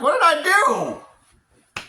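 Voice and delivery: very high, loud, drawn-out cry; mock wail. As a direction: wail it in hammed-up protest. Acted wail at being blamed.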